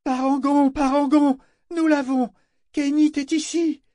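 very high, ringing call. A narrator's reading. Vivacia hails Parangon with news of Kénit, calling. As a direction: call out high, as if across the water. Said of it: "puis d'une voix plus forte".

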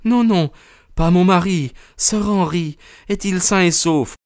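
very high, anxious female character voice; acted high-pitched alarm; distraught woman. A narrator's reading. Distraught rescued woman asks urgently.

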